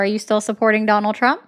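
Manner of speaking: ordinary talk, nothing marked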